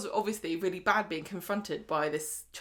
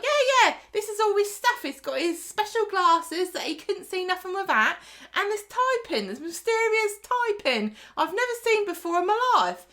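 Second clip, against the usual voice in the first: much higher and louder, breezy and bright; shrill chirpy woman; 12 semitones higher, 6 dB louder than usual. Retold or imitated. Woman offering Stephen pills, loud high chirpy voice. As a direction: toss it off like a chirpy, brassy woman. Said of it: "She's like".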